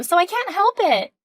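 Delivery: normal talking voice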